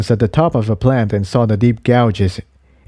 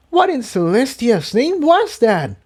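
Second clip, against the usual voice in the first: female character voice; 13 semitones higher than usual. Acted. Tiptoe shaken after fight.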